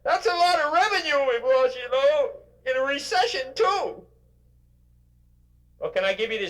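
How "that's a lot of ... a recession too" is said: high, whiny mock complainer voice; complaining employee. Speaker mocks an employee complaining about his job, in a high whiny mock voice. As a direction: play the griping worker, pitched way up. Mocking whining complainer voice continues.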